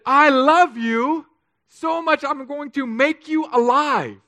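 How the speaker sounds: loud, high, slow declaration; impassioned proclamation. Acted. God tells the dead sinner of love, impassioned proclamation. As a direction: proclaim it with drawn-out full force. Voices God's declaration at full force.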